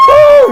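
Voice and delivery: loud high whoop; real high-pitched shouted cheer. Real, not acted. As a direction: let out a big "woo" for the crowd. Cheers partner in the audience.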